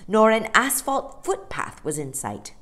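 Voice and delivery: ordinary delivery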